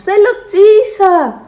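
high, loud, drawn-out yell; child shouting. A narrator's reading. Alfons tells Dad he must pee, shouting. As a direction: wail the urgent need slowly. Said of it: "φωνάζει ο Άλφονς".

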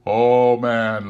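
loud, high ghostly outcry; exclaiming. Acted. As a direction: call out to him urgently. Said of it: "exclaimed the ghost".

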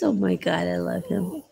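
ordinary talk, nothing marked

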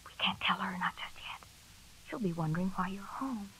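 hushed, whispered so mother can't hear; urgent whisper. Acted. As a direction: whisper it urgently to her husband. Hiding news from visiting mother.

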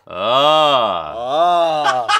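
copying the coach's warm-up ah; loud imitated warm-up vowel; voice exercise. Shown as a demonstration. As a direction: sing out a long ah after the coach. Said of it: "Ik wil eerst wat stemoefeningen doen".

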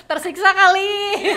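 loud laughing through words. Real, not acted. Laughing at student's struggle.

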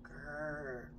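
low, slow muffled bear growl; a sleepy grumble through dust; lightly voiced muffled grrr. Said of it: "a muffled grrr".

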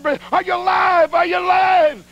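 high, panicked mock cry; hammed-up high-pitched alarm; frantic shout. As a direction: imitate a frantic friend calling to the injured. Frantic missionary over crash victim.

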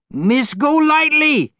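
high, loud, drawn-out angry call; angry shout. A narrator's reading. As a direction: yell her name down the stairs, furious. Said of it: "he shouted angrily".

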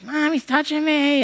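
high whiny tattle to mom; whiny tattling little sibling. Retold or imitated. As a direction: squeal it out like a tattling kid. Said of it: "the other one's like".

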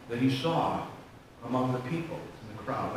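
ordinary talk

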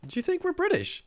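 very high, incredulous question; mock affronted. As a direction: squeak out your offended disbelief. Affronted at being called British.

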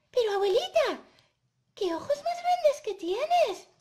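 put-on high, loud little-girl astonishment; amazed Caperucita. Caperucita noticing grandma's big eyes, amazed. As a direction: exclaim in wonder as a small girl.

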